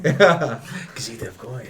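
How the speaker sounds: slow drawn-out Khajiit merchant. Retold or imitated. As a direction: stretch each word like a Khajiit trader. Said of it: "it's like you're with the Khajiits in Skyrim".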